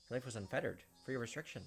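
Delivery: usual speaking voice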